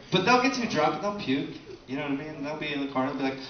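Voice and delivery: normal talking voice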